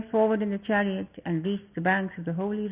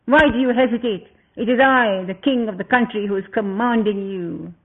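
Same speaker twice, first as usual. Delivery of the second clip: commanding king; 5 dB louder than usual. Acted. King Rama sends his brothers off with a loud command. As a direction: give a royal order that must be obeyed.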